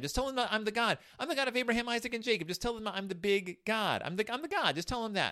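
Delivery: tossing off the answer up high; lightly voiced casual God; breezy God. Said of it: "And God's".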